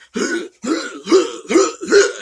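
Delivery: sharp, high, loud gasp of shock; a sudden shocked gasp. Retold or imitated. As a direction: gasp like you just saw the knife go in. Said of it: "And he's like".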